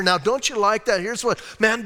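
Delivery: usual speaking voice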